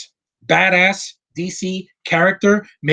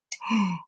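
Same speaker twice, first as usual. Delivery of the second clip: mock gasp; 5 semitones higher, 8 dB quieter than usual. Mock shock at DC on list.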